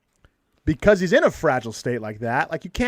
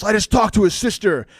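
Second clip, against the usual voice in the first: mock bawling; 2 semitones higher than usual. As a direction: retell it as a mildly hammed-up wail. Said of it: "like, bawling".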